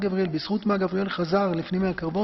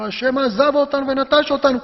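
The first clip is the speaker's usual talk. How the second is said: raised, louder indignant complaint; angry accusation; 5 semitones higher, 6 dB louder than usual. Acted. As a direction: act out a furious grievance. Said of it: "הגדעון מדבר בזעם". (האשמה זועמת)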